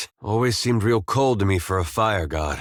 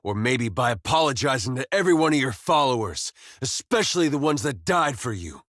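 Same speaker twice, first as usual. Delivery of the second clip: higher-pitched reproach, not shouted; indignant confrontation; 4 semitones higher than usual. Acted. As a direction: take the god to task, voice raised in pitch. Confronting a god over wrongs.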